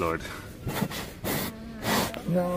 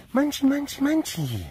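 higher singsong pet name; 9 semitones higher, 5 dB quieter than usual. Real, not acted. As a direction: coo it up high and soft.

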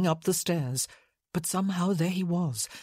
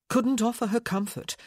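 ordinary delivery

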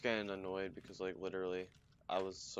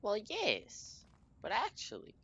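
sing-song teasing; 13 semitones higher than usual. Pretend.

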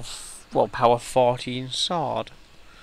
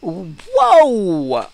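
surprised; 8 semitones higher, 13 dB louder than usual. Real, not acted. Sudden big loot drop.

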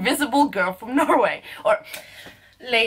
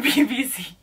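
ordinary talk, nothing marked

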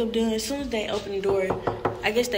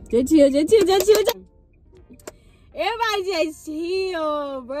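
worked-up self-calming; 7 semitones higher than usual. Real, not acted. Telling herself to chill, excitedly worked up.

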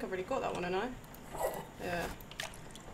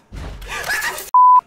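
sharp high gasp of fright; frightened gasp; 12 dB louder than usual. Real, not acted. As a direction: gasp as if jumped at. Jump scare in horror game.

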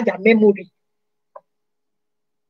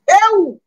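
disgusted ew; 9 semitones higher, 5 dB louder than usual. Real, not acted. Disgusted outburst in rant.

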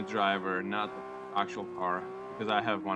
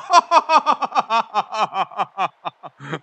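loud triumphant laughter; 10 semitones higher, 11 dB louder than usual. Real, not acted. After beating the Stig.

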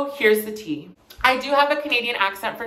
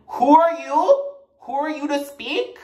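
mock indignation; 5 semitones higher, 4 dB louder than usual. Mock challenge to critics at school.

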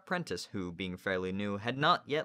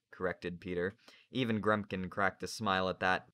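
usual speaking voice

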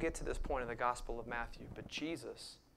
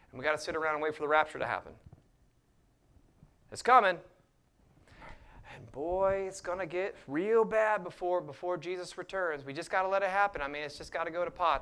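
louder, higher sarcastic parody; mocking; 3 semitones higher, 7 dB louder than usual. Preacher parodying rapture believers in loud mock dread. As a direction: ham up the doom, fast and loud.

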